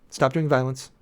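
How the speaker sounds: ordinary delivery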